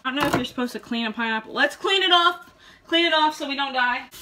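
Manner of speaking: ordinary delivery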